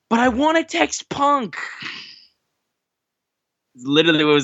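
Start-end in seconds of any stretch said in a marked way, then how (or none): 0.1-1.6 put-on high, slow, childish whine; whiny kid voice. Fan jokes to wrestler about texting Punk, whiny and high. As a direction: pout it like a jealous kid. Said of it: "I would just been like".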